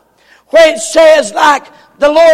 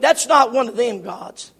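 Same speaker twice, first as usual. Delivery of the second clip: usual speaking voice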